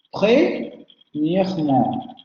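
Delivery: "Premièrement" said slowly, every syllable drawn out with a clearly sounded schwa; slow French demo. Shown as a demonstration. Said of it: "aquí estamos en una palabra".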